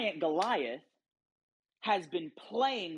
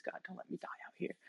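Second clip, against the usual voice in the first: desperate whispered prayer; 10 dB quieter, much faster than usual. Retold or imitated. Drowning surfer prays in desperation.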